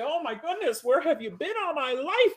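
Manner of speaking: delighted trainer gushing at a dog. Retold or imitated. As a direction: swoon over the perfect dog. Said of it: "And I'll say".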